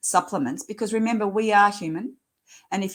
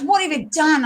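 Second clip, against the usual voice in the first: alarmed GP; 9 semitones higher, 6 dB louder than usual. Retold or imitated. GP demands on the phone.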